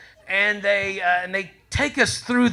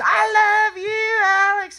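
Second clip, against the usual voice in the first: screamed love call; 13 semitones higher, slower than usual. Retold or imitated. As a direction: scream it up high like a crazed fan. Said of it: "And she screams".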